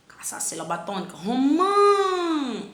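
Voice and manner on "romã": Romã stretched, MÃ sung high; teacher hunting the stress in Romã. Shown as a demonstration. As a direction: lift and hold MÃ. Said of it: "Ah, é no mã".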